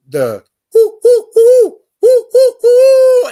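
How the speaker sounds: high, loud drawn-out owl hoots; owl hoot imitation. Retelling the owl that called near his sister, loud high hoots. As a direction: call like the owl just beyond the camp. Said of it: "instead of it going hoo".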